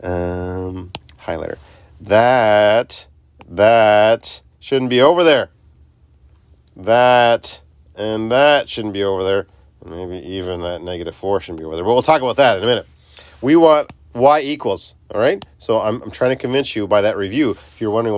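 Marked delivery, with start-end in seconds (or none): 2.0-9.5 slow and firm on "shouldn't be over there"; slow emphatic. Shown as a demonstration. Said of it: "teacher stressing the point". 13.4-14.9 "want" stressed, the whole line slowed. Shown as a demonstration. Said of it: "key point stressed".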